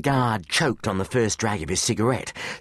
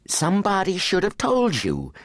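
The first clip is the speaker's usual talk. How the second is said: higher, mock-scolding tone; tutting; 11 semitones higher than usual. A narrator's reading. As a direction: tut at the fallen guard like a prim teacher. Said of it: "Jennifer tutted".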